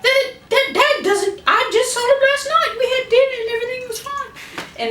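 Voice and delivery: high, squeaky bewildered voice; nerdy brother. Retold or imitated. Book-nerd brother protesting to his brother in a squeaky voice. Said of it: "he's like".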